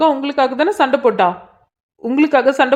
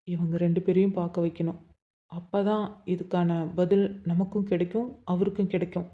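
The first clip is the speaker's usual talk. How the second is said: soft, hushed inner voice; inner thoughts; 5 semitones lower, 7 dB quieter than usual. Acted. (மனதுக்குள் பேசும் மெல்லிய குரல்)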